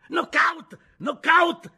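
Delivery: high, loud, hoarse screech; gloating shriek over the fallen man. A narrator's reading. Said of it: "strillava con voce rauca Oshka".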